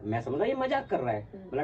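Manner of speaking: usual speaking voice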